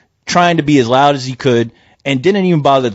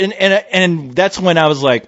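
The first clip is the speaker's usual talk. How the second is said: normal talking voice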